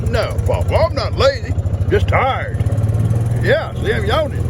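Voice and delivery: put-on high, childlike pet voice for the horse; high playful voice for his horse; voicing the horse. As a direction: speak for Hattie like a little kid. Grandpa voicing his horse Hattie.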